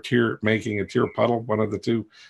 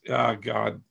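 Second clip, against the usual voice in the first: usual speaking voice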